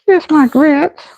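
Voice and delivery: high sassy quote; a sitcom waitress sass; TV catchphrase. Retold or imitated. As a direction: fire off the old catchphrase, way up high. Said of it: "Y'all remember that?".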